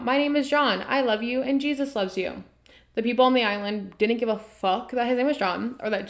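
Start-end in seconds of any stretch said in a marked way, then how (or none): 0.0-2.4 calling out cheerily to the islanders; raised, eager greeting shouted across the water; mock yell. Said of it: "John from his kayak yells out".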